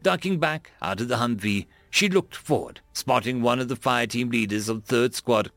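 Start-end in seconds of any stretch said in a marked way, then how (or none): none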